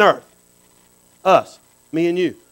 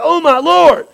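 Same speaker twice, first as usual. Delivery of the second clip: mock astonishment; 6 semitones higher, slower than usual. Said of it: "I said".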